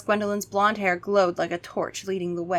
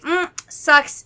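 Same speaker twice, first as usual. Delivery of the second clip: higher offhand shrug; bored vampire; 8 semitones higher, 4 dB louder than usual. Acted. Gwendolyn shrugging off the bottle, lightly voiced. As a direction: toss it off lightly. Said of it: "Gwendolyn's like".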